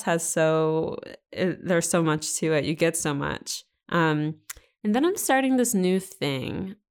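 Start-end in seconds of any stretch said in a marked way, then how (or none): none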